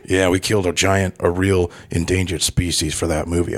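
put-on British action-star accent; Jason Statham. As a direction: talk like a laid-back British tough guy. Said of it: "how was his accent".

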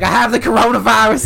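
high, loud mock panic; panicked meme impression. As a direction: yelp it like a frantic movie parody. Acts out a meme of the scene.

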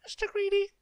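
tiny, very high-pitched squeak; frightened squeak. A narrator's reading. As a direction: squeak it out loudly in terror. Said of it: "he squeaked".

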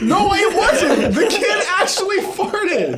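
very high, drawn-out squeal of protest; indignant protest. Real, not acted. Storyteller insisting to friends the kid did it, indignant protest. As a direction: squeal your defence through laughter. Defending herself against teasing.

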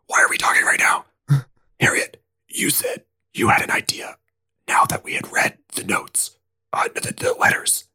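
hushed, fast whispered pleading; nervous whisper. Acted. Spencer whispers to Harriet, asking for her plan. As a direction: whisper urgently to the group. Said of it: "Spencer goes, "Guys, we should not be whispering".

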